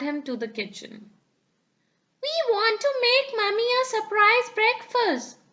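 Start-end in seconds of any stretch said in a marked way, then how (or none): 2.2-5.4 put-on high, eager little girl voice; bubbling over with excitement; excited. A narrator's reading. Peppa excitedly tells Daddy her breakfast plan, high-pitched and eager. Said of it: "said Peppa excitedly".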